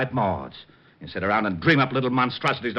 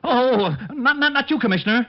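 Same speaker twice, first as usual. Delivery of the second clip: much higher, stammering apology; flustered panic; 14 semitones higher than usual. Acted. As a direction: caught out and scrambling to explain.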